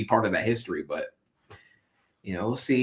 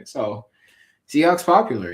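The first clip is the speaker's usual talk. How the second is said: normal talking voice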